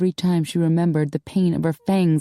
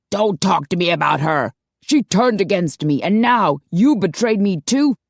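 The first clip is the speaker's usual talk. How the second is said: building from firm to loud and hurt; angry father, growing louder; rising anger; 3 semitones higher, 3 dB louder than usual. Acted. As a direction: let the betrayal boil over.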